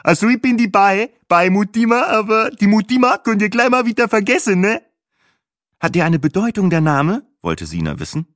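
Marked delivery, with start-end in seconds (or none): 0.0-4.8 high, loud, squawky voice; squawky, easygoing guide on a headset; Bahe, chummy tour driver. A narrator's reading. 5.8-7.2 raised, louder voice; curious passenger. A narrator's reading. Sina asks about the name.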